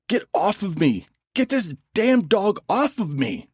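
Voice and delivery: high frantic scream. A narrator's reading. Travis screams at the dog biting him. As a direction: yell in panic as the dog bites. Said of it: "Travis screamed".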